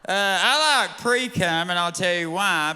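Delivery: normal talking voice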